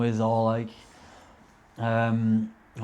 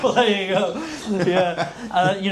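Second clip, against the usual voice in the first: much higher, words riding on laughter; laughing it off; 7 semitones higher than usual. Real, not acted. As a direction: still giggling as you answer. Laughing at friend's joke.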